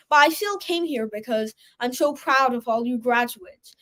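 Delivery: ordinary delivery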